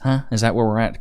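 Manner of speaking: normal talking voice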